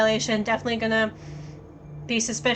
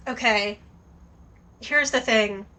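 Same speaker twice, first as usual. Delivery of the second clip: normal talking voice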